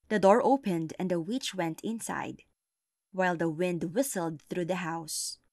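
plain reading voice

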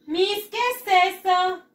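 put-on high, loud, slow child's question; clueless pupil. Pupil asking Miss what this is, mock bewildered. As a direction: wail it as a puzzled pupil.

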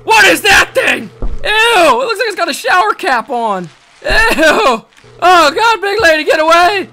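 high, loud frantic yelling; panicked shouting. Real, not acted. Gamer urging his character to flee, panicked shouting. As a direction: yell at her to run. Alien monster chasing character.

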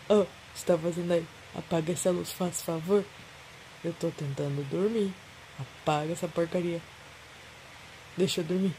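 low grumpy half-asleep complaint; rudely awakened. Real, not acted. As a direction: grumble like someone woken by a lamp. Sleeper grumbles at the light.

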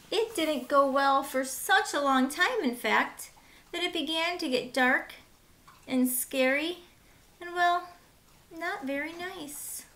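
put-on bright, lively read-aloud for kids; lively children's storyteller; storybook narration. Reading picture book to children.

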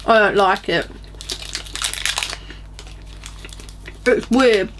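muffled chewing speech; talking while chewing. Real, not acted. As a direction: mouth partly full of chocolate. Seen 3: Bites bar at -4; closed-mouth grimacing while holding it until 6.5.

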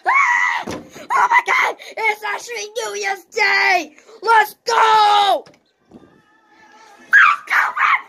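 shouting with joy at midnight; wild, high, ecstatic cheering; new year countdown crowd. Real, not acted.

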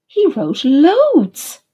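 he wrote "loads" said slowly with a big high lift; emphasis on loads. A narrator's reading. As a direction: lean hard on "loads", drawing it out. Narrator emphasising many postcards.

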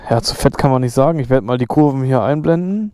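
ordinary delivery

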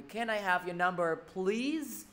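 slow English, PLEASE ending in a buzz; final z. Shown as a demonstration. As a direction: say it slowly and close PLEASE on z. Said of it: "mà thầy đọc là z".

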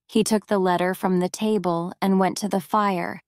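ordinary delivery

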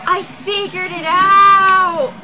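loud, slow, proud cry; triumphant shout. Real, not acted. Gamer solving the puzzle, shouting in triumph. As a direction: shout the discovery. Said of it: "I feel so smart right now".

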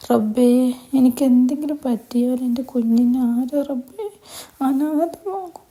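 father prays to God, crying for his daughter; tearful prayer. Acted. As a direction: voice breaking into crying, rising high.

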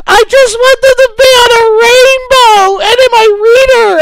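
a wailing mock sob of love for blogs; teary gushing wail. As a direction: overflow with hammed-up tearful adoration. Climax of mock tearful gush.

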